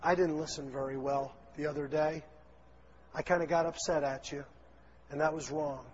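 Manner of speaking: the right way, humbly apologizing; sincere apology. Pretend. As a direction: own your fault, gently. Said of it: "We just tell him what our fault was".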